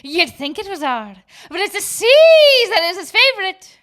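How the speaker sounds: loud, rising mock pirate voice; pirate accent. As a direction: ham up a pirate for the punchline. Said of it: "And a good accent".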